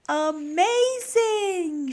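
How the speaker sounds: high, loud, very drawn-out cheer; joyful shout. A narrator's reading. Snip hugging his dad, shouting joyfully. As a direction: shout it with delight, stretching each syllable. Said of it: "shouted Snip".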